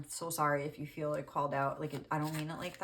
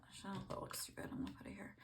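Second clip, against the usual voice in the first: barely voiced self-talk; whispered aside; 4 semitones higher, 12 dB quieter, much faster than usual. Real, not acted. Reader to herself while placing a card, whispered aside. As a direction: whisper it to yourself, much softer.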